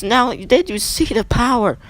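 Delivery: slow, high, thrilled call; hyped-up commentator awe; excited announcer. Real, not acted. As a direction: marvel at the power. Hyped announcer at big move.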